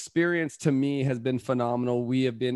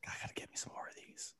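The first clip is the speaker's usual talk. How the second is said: whispered greedy aside; 22 dB quieter, faster than usual. Pretend. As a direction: whisper it to yourself, craving more.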